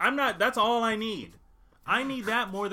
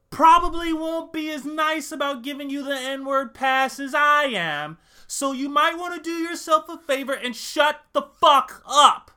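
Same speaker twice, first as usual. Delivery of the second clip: loud, raised-pitch mock lecture; emphatic warning; 8 semitones higher, 10 dB louder than usual. Warning listeners not to push their luck, emphatic and loud. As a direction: build to a punched, shouted finish.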